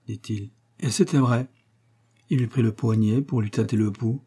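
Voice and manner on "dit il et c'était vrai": usual speaking voice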